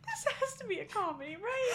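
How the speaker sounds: high, laughing through the words; laughing disbelief. Real, not acted. Reacting to the car scene, real laughter. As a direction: barely hold it together.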